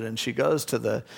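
ordinary delivery

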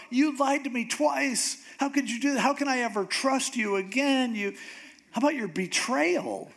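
high, hammed-up tearful wail; mock crying. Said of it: "crying even more".

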